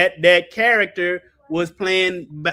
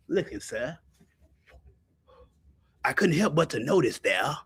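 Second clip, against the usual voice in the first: low, soft Columbo voice; Columbo impression; 5 semitones lower, 10 dB quieter than usual. Preacher doing Columbo for his audience, low and soft. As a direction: play the humble rumpled detective, quietly. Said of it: "what my cigar like Columbo?".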